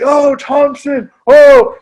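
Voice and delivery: high, loud crowd hype; kids hyped up. Retold or imitated. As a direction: whoop it up like an excited class. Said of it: "my kids are like".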